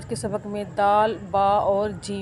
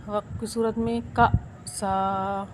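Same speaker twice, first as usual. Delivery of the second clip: ordinary talk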